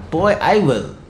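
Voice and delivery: ordinary talk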